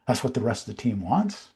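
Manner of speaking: "wants" rising at the end, curious; upward inflection. Shown as a demonstration. Salesman mirrors the client, curious rise on "wants". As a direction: mirror it back, lifting "wants" like a question. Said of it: "upward inflection at the end".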